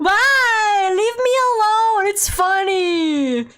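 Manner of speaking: high, loud fake whine; mock whining. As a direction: whine as if hurt by the teasing, hammed-up.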